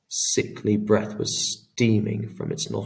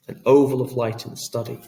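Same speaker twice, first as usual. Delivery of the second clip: usual speaking voice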